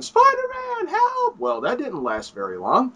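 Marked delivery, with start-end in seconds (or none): 0.0-1.4 high shrilled call for help; mock panicked plea, much higher; cry for help. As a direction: shout it up high like a panicked bystander. Someone calling for help.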